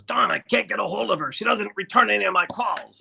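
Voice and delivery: the agent tells Don he can't reach her; Dick impression. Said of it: "I do a better Dick".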